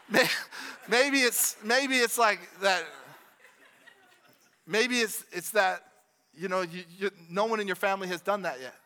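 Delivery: ordinary delivery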